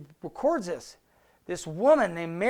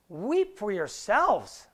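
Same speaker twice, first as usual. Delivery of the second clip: slow solemn quote; much slower than usual. Acted. Repeats Jesus' words to women.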